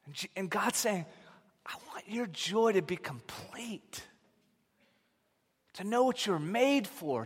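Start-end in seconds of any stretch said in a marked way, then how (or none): 1.6-7.2 slow, softer whisper. Acted. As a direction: whisper it like a secret promise. Voicing God tenderly.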